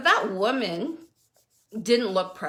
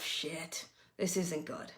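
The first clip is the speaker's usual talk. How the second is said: much softer, under the breath; muttered dismay; 3 semitones lower, 11 dB quieter, slower than usual. Retold or imitated. Mom finding surprise homework in a backpack, muttered dismay.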